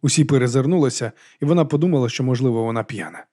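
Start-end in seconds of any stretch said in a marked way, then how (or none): none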